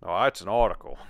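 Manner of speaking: a short go at Aussie vowels; attempted Australian accent. Shown as a demonstration. Co-host shows off the requested accent, mock Australian. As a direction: put on a rough Australian accent. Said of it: "and the accent?".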